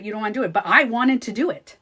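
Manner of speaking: ordinary delivery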